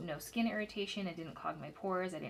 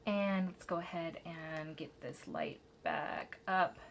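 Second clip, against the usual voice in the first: ordinary delivery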